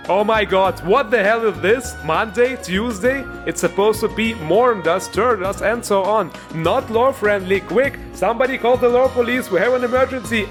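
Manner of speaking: high-pitched, hammed-up panic; mock outrage. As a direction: play up a lore nerd's alarm. Mock lore-police outrage, then "jokes aside.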